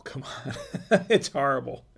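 laughing it off, embarrassed. Real, not acted. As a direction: chuckle through the words about the accent. Laughing at own French accent.

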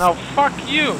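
high, loud yelled insult; shouting. Acted. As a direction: yell it back. Screaming match continues.

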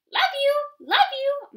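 very high squeaky mama voice. A narrator's reading. Mother mouse squeaking love to her babies. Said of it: "Mama squeaked".

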